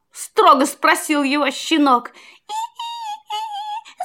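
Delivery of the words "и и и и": very high, thin mouse squeak. A narrator's reading. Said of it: "запищал".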